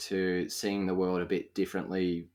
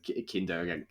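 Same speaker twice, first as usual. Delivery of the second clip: ordinary delivery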